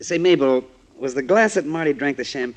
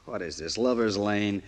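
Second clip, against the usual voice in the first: usual speaking voice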